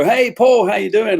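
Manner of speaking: high, loud, cheery hello from a fan; mock-cheerful stranger hailing a local celebrity; friendly stranger greeting. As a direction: call out brightly like a familiar acquaintance. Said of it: "So people go".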